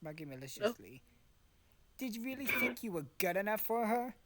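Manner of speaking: ordinary delivery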